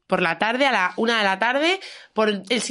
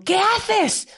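much higher outraged shout of what; retold outraged cry; 10 semitones higher than usual. To the kicking father, a high shocked shout. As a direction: cry out at the man who kicked her dog. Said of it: "Le digo".

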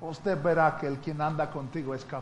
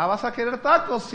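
higher, louder questioning waitress; 7 semitones higher, 7 dB louder than usual. Retold or imitated. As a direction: ask like a waitress expecting the usual. Waitress asks the usual. (mesera preguntando, más alto y fuerte)

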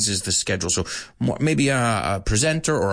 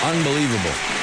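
announcer voice; faster than usual. Acted. Sound effect cheering.

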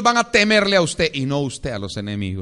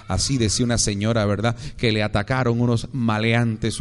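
usual speaking voice

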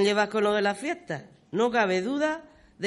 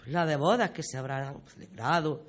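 low, tender reminiscence, a touch emotional; moved; 5 semitones lower, 4 dB quieter than usual. Acted. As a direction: let the memories touch you, voice dropping. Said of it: "hasta me emociono". (emocionada, en tono bajo)

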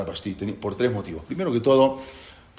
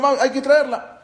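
eager demand; 13 semitones higher, 7 dB louder than usual. Retold or imitated. Crowd demanding Vashti be brought.

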